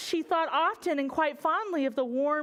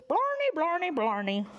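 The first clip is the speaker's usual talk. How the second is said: mock sailor gibberish; 6 semitones higher than usual. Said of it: "spoke a language she did not understand".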